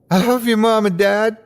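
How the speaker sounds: high tearful calling; joyful cry. A narrator's reading. Calling to parents, crying.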